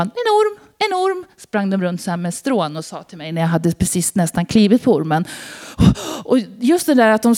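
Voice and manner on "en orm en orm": high mocking squeal of teenagers; teasing kids' cry. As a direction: tease like a kid scaring a friend. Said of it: "retades de lite". (hög retsam ungdomsröst)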